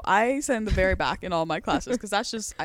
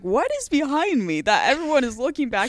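higher, louder puzzled question; mock confusion; 5 semitones higher, 5 dB louder than usual. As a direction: act baffled, looking behind you. Acting confused in class.

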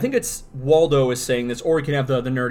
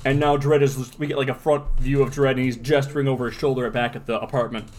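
usual speaking voice

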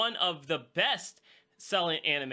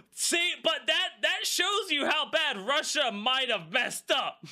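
excited outburst; 10 semitones higher, slower than usual. Real, not acted. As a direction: shout it out through laughter. Laughing outburst about the war news.

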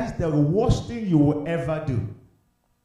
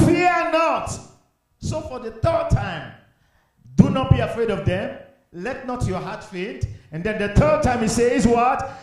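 high, emphatic scripture proclamation; ringing, insistent sermon delivery; impassioned preaching; 5 semitones higher, 3 dB louder, slower than usual. Real, not acted. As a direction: drive each command home to the congregation. Preaches the verse with force.

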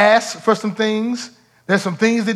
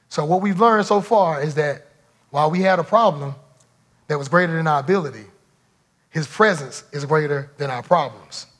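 normal talking voice

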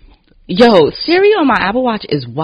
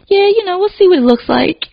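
noncommittal; 11 semitones higher, 4 dB louder than usual. Retold or imitated. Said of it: "things that made me very unsure".

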